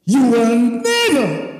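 never stretched high and loud; stress on never. Real, not acted. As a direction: lean hard on never. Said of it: "Take note of this now".

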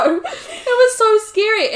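high, loud, slow laughing words; laughing slowly. Real, not acted. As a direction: drag it out through real laughter. Laughing about the duck chase.

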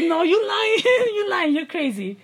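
high, laughing mock disbelief; retold disbelief. Host retells reacting to a ghost story, laughing disbelief.

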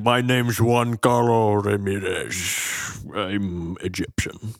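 put-on slow, slurred Scottish-accent parody; Sean Connery impression. Host mocking Scottish actor playing an Egyptian, Scottish-accent impression. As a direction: drag it out in a mock Scottish burr. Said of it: "He's like".

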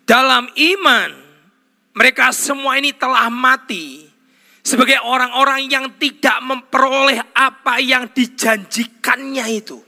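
emphatic pulpit reading; declaimed scripture reading. Real, not acted. Declaims Bible verse at full force.

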